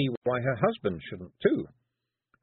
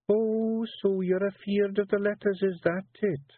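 high Scots teasing, sly; wife goading her husband artfully; 11 semitones higher than usual. A narrator's reading. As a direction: bait him cunningly. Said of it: "said Jeanie artfully".

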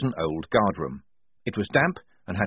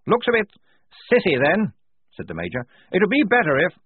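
high, loud hearty officer voice; blustering major; 10 semitones higher, 5 dB louder than usual. Acted. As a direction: boom out like a jolly old officer.

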